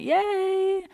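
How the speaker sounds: high, drawn-out little cheer; sing-song celebration; mock cheer. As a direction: squeal it way up high. Acted cheer after goodnight.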